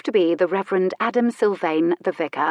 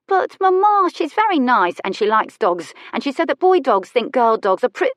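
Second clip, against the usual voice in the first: put-on high, loud little boy protesting; a child begging his mother, much higher; pleading child; 6 semitones higher, 5 dB louder than usual. A narrator's reading.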